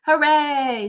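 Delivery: loud happy cheer; excited cheer. Acted. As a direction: burst out with joy. First day, ran to school.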